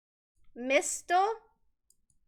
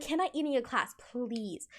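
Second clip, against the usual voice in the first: softer, sugary little request; sweet asking; 3 semitones lower, 7 dB quieter than usual. Retold or imitated. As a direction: ask like the teacher's pet, all sweetness. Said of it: "I would be like".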